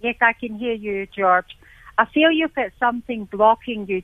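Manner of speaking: usual speaking voice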